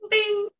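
high, loud spark of sound; bright "bing" chime. Shown as a demonstration. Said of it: "the sound that makes dreams come true".

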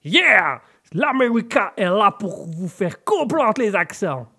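loud, high mock-American French; slow, hammed-up stage American; McDonus. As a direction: belt it out in an American accent. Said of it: "pour le côté américain".

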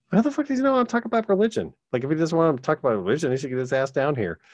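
high, fast mock outrage; mock indignant rant, fast and high; incredulous outrage. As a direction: fire it off in disbelief, way up high. Kevin's incredulous retort retold.

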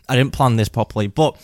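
ordinary talk

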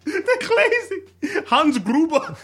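very high, laughing German caricature; campy German accent. Acted. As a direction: squeal it in a mock German accent, amused.

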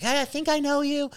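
high mock-friendly hello; imitated wife. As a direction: chirp it as the retold wife. Wife's retold greeting, voiced high.

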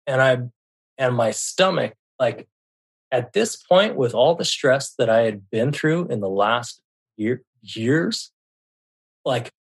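ordinary talk, nothing marked

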